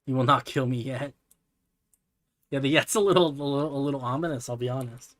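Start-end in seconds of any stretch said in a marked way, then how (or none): none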